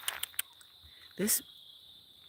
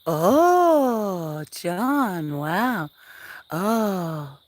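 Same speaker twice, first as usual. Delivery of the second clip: loud, high delighted exclaim; surprised; 3 semitones higher, 13 dB louder, much slower than usual. Real, not acted. Streamer reacts to John's news, loud delighted wow. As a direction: burst out in happy surprise.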